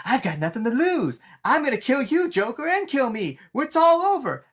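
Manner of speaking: mock crazed last stand; desperate threat. Charlie threatening the Joker with the bomb, mock frenzy. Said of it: "and he's like".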